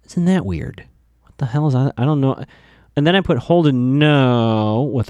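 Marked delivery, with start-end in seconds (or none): 4.0-4.9 NO stretched out very long; long, lingering "no"; drawn-out no. Retold or imitated. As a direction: hold the O in "no" as if written eighteen times. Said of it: "like, eighteen O's".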